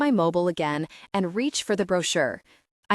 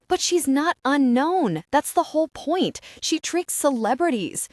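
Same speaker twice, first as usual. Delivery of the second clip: excited protest; 7 semitones higher than usual. Acted. Becky protests to her husband.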